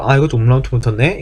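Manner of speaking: usual speaking voice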